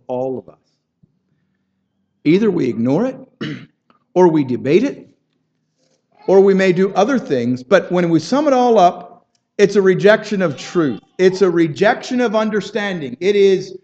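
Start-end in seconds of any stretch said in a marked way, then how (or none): 2.2-13.8 raised, pressing pulpit voice; fervent preacher building intensity; impassioned preaching. Acted. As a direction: drive each question home to the congregation. Preaching climax on rejecting truth.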